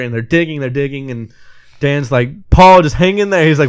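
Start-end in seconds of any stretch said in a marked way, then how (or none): 2.5-3.5 urging. Retold or imitated. Soldier urging trapped man on phone.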